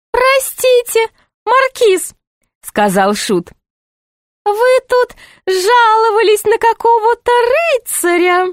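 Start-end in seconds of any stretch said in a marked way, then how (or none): 0.1-2.1 very high, sing-song jester voice; put-on high jester voice; high-voiced jester. 4.4-8.5 very high, sing-song jester voice; put-on high jester voice; high-voiced jester.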